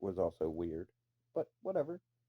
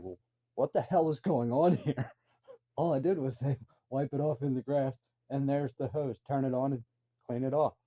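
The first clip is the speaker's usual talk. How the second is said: higher, louder bewildered protest with a laugh; retold shock; 5 semitones higher, 4 dB louder than usual. Retelling his confusion at two crying kids, shocked protest. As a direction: act out baffled disbelief, voice pushed up. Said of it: "I was shocked. I, I didn't know".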